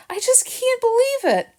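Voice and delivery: high, teary, near breaking; tearful disbelief. Acted. Jill to Harrison, crying over Chester, tearful. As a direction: plead through the tears. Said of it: "Jill was saying between sobs".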